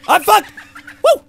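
shrieking laugh of panic; laugh-scream in fear; panicked laughing shout. Real, not acted.

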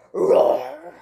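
loud monster roar. Acted. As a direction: roar out like a beast. Monster roars at girl.

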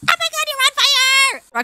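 very high squealed alarm; hammed-up high-pitched freak-out; mock panic. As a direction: imitate a crowd panicking, voice shooting way up. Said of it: "Everybody crowded in this tiny-ass bathroom just being like".

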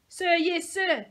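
high, loud drill shout; barked military reply. A narrator's reading. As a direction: snap it like a recruit. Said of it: "the cadet shouted".

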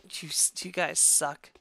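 unvoiced, muttered insult; whisper. Real, not acted. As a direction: whisper it bitterly.

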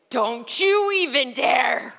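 slow, loud seething warning; rage. Retold or imitated. Snapping at her husband, slow and furious. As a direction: spit it out slowly, daring him. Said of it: "my rage and my anger would boil".

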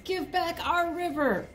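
a little bunny begs the lion for the river, high and small. Acted. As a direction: squeak it out like a small rabbit. Bunny protests.

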